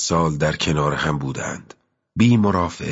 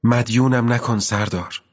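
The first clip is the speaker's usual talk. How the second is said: put-on much higher girl's voice, politely declining; higher voice of the young girl refusing help; girl to commander, higher and polite; girl's polite refusal; 7 semitones higher than usual.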